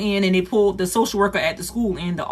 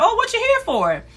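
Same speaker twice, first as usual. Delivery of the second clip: much higher, suspicious greeting; wary grandma; 13 semitones higher than usual. Retold or imitated. Grandma confronting the social worker at the door, much higher. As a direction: question the visitor's motive. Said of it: "my grandma was like".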